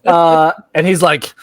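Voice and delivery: usual speaking voice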